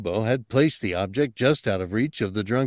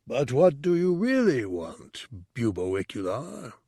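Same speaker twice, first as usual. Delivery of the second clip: higher, distant questioning voice; probing monk voice; 3 semitones higher than usual. Acted. The teacher presses Bubo on his motives, distant. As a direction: press him gently, from far off.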